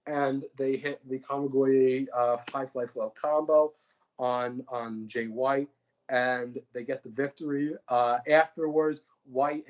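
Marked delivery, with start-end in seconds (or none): none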